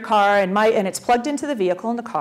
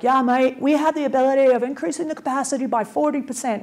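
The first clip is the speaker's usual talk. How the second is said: put-on Aussie accent, pitched up; Australian accent; 5 semitones higher than usual. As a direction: imitate a chatty Aussie mate. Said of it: "try to imitate an Australian accent".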